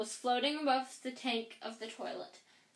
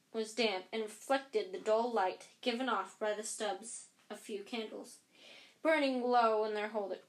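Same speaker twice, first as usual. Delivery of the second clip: plain reading voice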